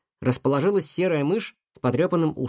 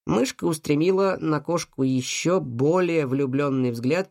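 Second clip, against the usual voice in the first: ordinary delivery, nothing marked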